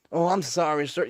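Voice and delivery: high, sheepish apology from a busted coworker; mock apology. Coworker apologizing after seeing the badge, mock sheepish. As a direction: play the coworker backing down fast.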